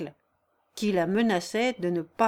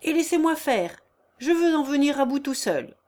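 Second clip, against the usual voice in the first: high, raised-voice demand; shouted insistence; 6 semitones higher, 4 dB louder than usual. A narrator's reading. Peasant taking over the job alone, shouted insistence. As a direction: call over the men, taking charge. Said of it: "cria tout à coup une voix".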